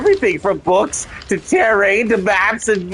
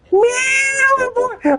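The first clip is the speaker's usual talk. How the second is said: high exclamation; slower than usual. Real, not acted. Exclaiming at game.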